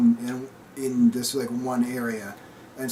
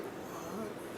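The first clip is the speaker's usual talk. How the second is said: whispered what; 19 dB quieter than usual. Retold or imitated. Townsfolk gasping at Django.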